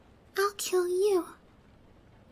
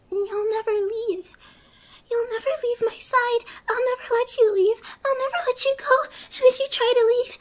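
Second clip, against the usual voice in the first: builds from raised to a high, loud, teary cry; rising possessive frenzy; 5 semitones higher, 5 dB louder than usual. Acted. Captor vowing to her captive that he will never leave, rising frenzy. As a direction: let it climb until she is nearly shrieking.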